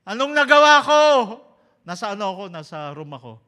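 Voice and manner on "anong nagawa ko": high, loud frightened yell; retold scream. Boy crying out in his room after the nightmare, screaming. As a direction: scream it out in panic. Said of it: "Bigla akong sumigaw".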